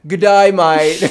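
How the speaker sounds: correcting a friend with a hammed-up Australian voice; mock Australian accent. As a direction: belt out a cartoon Australian greeting. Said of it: "that's still Australian".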